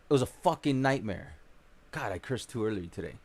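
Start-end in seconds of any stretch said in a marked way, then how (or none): 1.9-3.2 quiet aside. Real, not acted.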